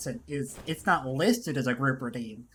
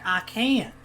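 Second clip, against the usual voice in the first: retold yell; 8 semitones higher, 5 dB louder than usual. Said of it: "and I'm like".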